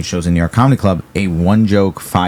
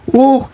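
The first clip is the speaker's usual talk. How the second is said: release whoop; 5 dB louder than usual. Real, not acted. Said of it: "Could not handle the amount of emotion".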